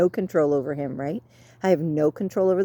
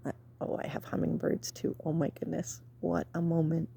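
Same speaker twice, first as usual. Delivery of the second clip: whispered wonder at hummingbirds; quietly marveling at a hummingbird nearby; hushed whisper; 10 dB quieter than usual. Real, not acted. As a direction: keep it hushed, full of awe.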